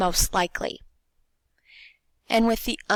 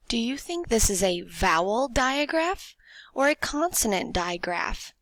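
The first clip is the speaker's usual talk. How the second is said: ordinary talk, nothing marked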